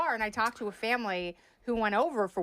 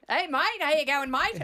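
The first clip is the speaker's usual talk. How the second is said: mock Aussie greeting, raised pitch; Australian accent; 5 semitones higher than usual. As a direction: do a cheerful Aussie mate. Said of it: "Did you call everybody mate".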